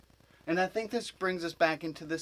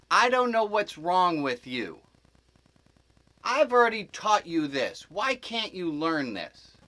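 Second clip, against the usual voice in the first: raised voice, imitating a frustrated teacher; exasperated teacher scolding; 4 semitones higher, 7 dB louder than usual. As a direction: scold the students, slowing on the last question. Voices teacher berating his students.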